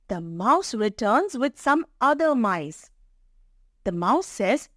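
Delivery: ordinary delivery, nothing marked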